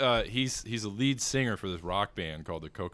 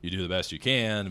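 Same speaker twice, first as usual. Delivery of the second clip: ordinary talk, nothing marked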